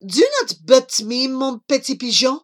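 put-on loud, amorous French-accented pigeon; cooing French suitor. Jean-Claude edging closer to Charlie, cooing insistently. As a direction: brush off the protest like a French lover. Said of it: "Jean-Claude cooed".

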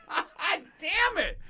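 high slow mock startled curse. Retelling firecrackers thrown at the kids, startled curse. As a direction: yelp it, then drag the curse out. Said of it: "It's like".

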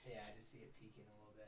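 whisper. Real, not acted. Adjusting mic levels.

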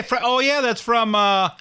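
ordinary talk

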